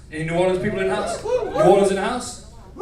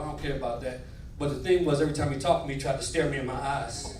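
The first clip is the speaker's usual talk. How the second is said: normal talking voice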